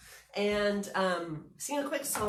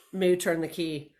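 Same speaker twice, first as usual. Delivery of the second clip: ordinary delivery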